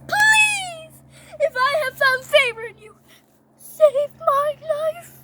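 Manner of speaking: high, loud mock pleading; desperate plea. Queen begging king for her life, pleading. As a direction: beg in a hammed-up high voice.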